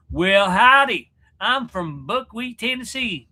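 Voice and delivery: slow, high Southern twang. Shown as a demonstration. Host showing a Brit an American accent, slow Southern drawl. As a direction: drawl it like a Tennessee yokel, hammed up. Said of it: "you know, like that".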